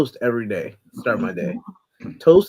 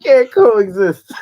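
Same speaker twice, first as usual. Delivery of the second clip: slow laughing repeat; 10 dB louder, much slower than usual. Real, not acted. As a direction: stretch it out while laughing.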